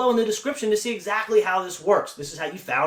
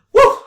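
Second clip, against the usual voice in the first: excited whoop; 16 dB louder than usual. Real, not acted. Relieved after rushing through recap.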